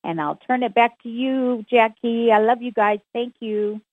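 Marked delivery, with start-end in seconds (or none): none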